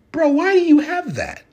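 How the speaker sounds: high, loud, baffled "bro" question; the deacon is alarmed by the collar; shocked deacon. Retold or imitated. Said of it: "And he's like".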